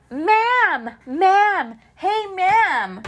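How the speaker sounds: high mock call of ma'am; kid calling out. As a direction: imitate the kid shouting ma'am after her. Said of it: "is yelling at me".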